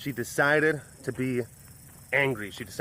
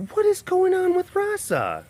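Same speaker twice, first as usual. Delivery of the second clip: high, coaxing voice; pet talk; 4 dB louder than usual. Real, not acted. Asking about the cat.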